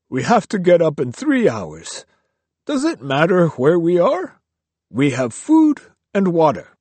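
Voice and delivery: curt, weary monk brushing the question aside; dismissive monk. A narrator's reading. Riku dismissing Kyo's question at night. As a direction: shut the talk down with a scoff.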